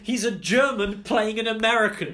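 high, loud, incredulous questioning; mock exasperation. Real, not acted. Co-host ranting about a confusing film name, exasperated.

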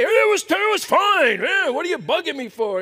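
mock snapping husband. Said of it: "it's easy to lash out".

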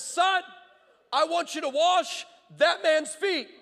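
loud, high, slow proclamation as God; booming mock-divine summons. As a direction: call out a divine command, drawing out each word. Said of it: "then God starts speaking to me".

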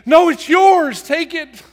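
high, loud urgent cry; desperate insistence. Acted. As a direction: shove the burden away with your voice. Acts desperate casting of anxiety on God.

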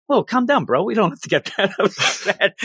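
a lightly voiced chill bro; calming bully. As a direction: soothe him like a cocky bully. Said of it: "Mamoto's like".

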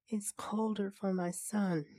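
ordinary delivery

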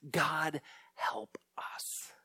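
breathy whispered plea for help; hushed desperate prayer. Retold or imitated. Parents in the basement begging God, whispered. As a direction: whisper it like you're clinging on in the dark.

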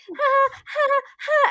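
high, nervous mock giggle. Maid of honor giggling nervously at the mic, mock giggle. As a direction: giggle through the stage fright. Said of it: "I had major stage fright".

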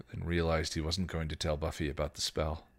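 usual speaking voice

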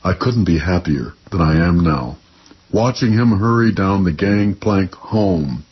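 measured, lyrical poem reading; poetry reading. Acted. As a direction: read it like a poet on stage. Poet reads his poem.

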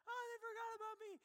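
high, fast, whiny wail; overplayed self-pity, rushed; mock whining. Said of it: "throwing this pity party".

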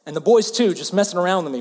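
ordinary talk

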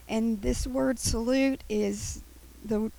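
ordinary delivery

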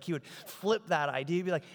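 ordinary delivery

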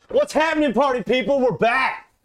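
loud, high show host shout; hype intro. Acted. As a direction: pump up the crowd loudly. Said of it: "Time for the intro".

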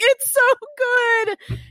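wailing it out high and loud through tears; a high, loud sob-wail over a K-drama; mock sobbing wail. To her husband, wailing high and loud through tears. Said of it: "I was crying so hard".

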